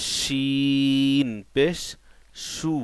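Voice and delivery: শিন পেশ stretched very slowly; chanted Arabic letter drill; slow drawn-out drill. Shown as a demonstration. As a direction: draw out শিন পেশ in a long recitation. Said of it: "সিন".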